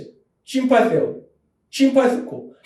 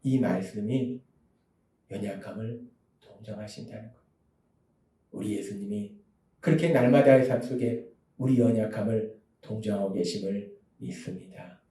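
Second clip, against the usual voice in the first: much lower and softer, hushed; hushed, lower sincere affirmation; quiet earnest close; 10 semitones lower, 11 dB quieter than usual. Real, not acted. Quiet prayerful close after comfort. (낮고 조용한 확신의 목소리)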